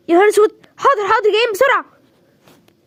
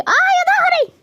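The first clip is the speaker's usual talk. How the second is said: put-on old woman groan; 13 semitones higher, much slower than usual. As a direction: complain of the aching back. Old woman groans about back.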